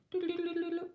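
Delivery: high-pitched rapid doo-doo babble; idle doo-doo trill. Real, not acted. Reader searching for the paid question, idle high trilling. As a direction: fill the wait with a high tongue-tripping trill.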